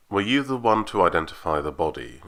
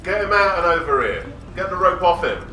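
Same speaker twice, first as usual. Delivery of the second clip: urgent shouted orders; 12 semitones higher than usual. Acted. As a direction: command the rescuers to get him out fast. Rescue leader giving urgent orders.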